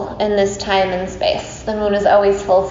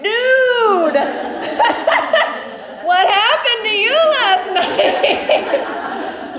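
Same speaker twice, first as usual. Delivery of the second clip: high, drawn-out, laughing tease; mock incredulous teasing; 12 semitones higher, much slower than usual. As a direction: play the gleeful friend, laughing in a high voice. Teasing friend's morning phone call.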